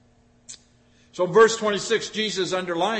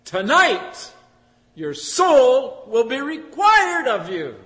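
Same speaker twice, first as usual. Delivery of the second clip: voice of God judging; 6 semitones higher, 6 dB louder, much slower than usual. Acted. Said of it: "God said".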